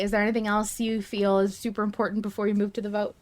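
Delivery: ordinary talk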